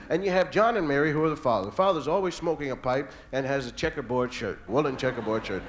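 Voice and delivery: ordinary delivery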